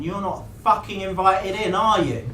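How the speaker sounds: loud, high, drawn-out indignant mockery; mock offended. A narrator's reading. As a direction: act offended, slow and loud. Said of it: "Rolf mocks, looking offended".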